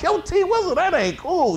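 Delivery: high, loud mock protest; retold indignant objection. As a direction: push him away with a squeaky complaint. Retold protest at tongue in ear.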